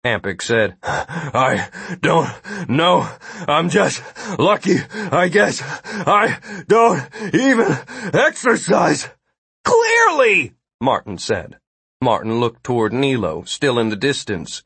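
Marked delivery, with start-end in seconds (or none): 0.8-9.1 slow, panting, word by word; winded. A narrator's reading. Big guard admits he never exercises, winded. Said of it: "the big guard was already winded". 9.6-10.5 high, loud exasperation; exasperated retort. A narrator's reading. Martin retorts to the unfit guard, exasperated.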